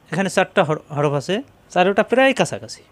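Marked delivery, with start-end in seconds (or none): none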